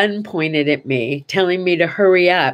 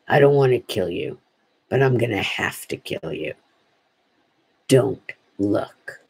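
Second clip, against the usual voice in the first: menacing robber; 7 semitones lower than usual. Retold or imitated. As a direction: voice a gunman's warning, low and measured. Voices the threatening armed robber.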